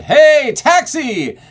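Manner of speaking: hailing a cab at full volume; high, loud belted shout; yelled call. Shown as a demonstration. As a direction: call out across the street. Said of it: "an expression or a need to yell".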